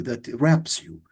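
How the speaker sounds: ordinary talk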